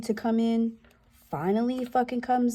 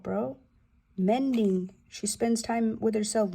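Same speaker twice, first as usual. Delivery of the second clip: ordinary delivery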